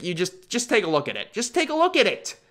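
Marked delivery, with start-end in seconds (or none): 1.3-2.4 much higher, incredulous; pitched-up exasperated plea; exasperated high repeat. Shown as a demonstration. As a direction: squeak it out in disbelief. Repeats phrase, second time pitched up.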